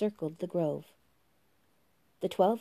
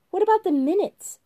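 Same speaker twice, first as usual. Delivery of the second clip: much higher, louder, petulant question; January, shrill and fussy; 12 semitones higher, 8 dB louder than usual. Acted. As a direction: whine it like a stickler. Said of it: "asked January".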